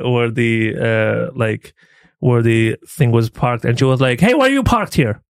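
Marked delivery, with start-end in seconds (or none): 4.2-5.2 bossy order. Retold or imitated. Bossy desk woman ordering driver.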